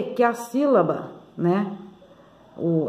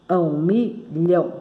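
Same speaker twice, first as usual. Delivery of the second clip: milhão slowly split into mi... lhão; syllable by syllable; 2 semitones lower, slower than usual. Shown as a demonstration. Teacher showing two syllables, milhão slowly pulled apart. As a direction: say milhão in two separate beats. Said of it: "tem duas sílabas".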